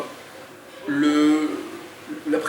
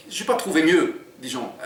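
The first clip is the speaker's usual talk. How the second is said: lively read-aloud narration; story reading; 3 semitones higher than usual. A narrator's reading. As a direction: read the chapter to draw listeners in. Author reads his book aloud.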